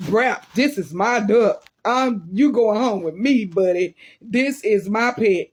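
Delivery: slow, emphatic possessive declaration; insistent claim. Retold or imitated. Child telling the duck it's going home, firmly possessive. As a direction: lay claim, word by word.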